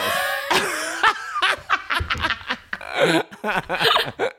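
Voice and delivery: high rising burst of laughter; big laugh. Real, not acted. Telling hosts the wine story, big laugh. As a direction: laugh out loud at the punchline.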